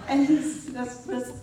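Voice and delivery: ordinary talk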